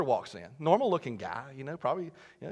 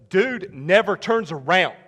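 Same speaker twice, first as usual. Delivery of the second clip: ordinary talk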